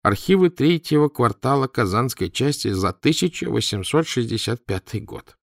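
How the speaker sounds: ordinary delivery, nothing marked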